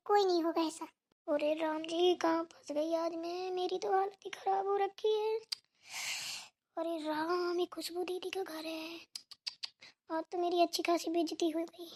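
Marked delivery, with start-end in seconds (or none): 1.3-11.9 much softer, slow frightened muttering; panicked whisper to self. Acted. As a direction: whisper your panic so no one hears. Caught guest whispers in panic. (पकड़ी गई मेहमान घबराकर खुद से फुसफुसाती है)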